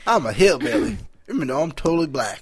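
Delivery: lightly hammed Southern hillbilly accent; mock North Carolina accent. Mocking his girlfriend as a hillbilly, light Southern accent. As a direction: put on a teasing North Carolina twang. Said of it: "this fucking North Carolina accent will come".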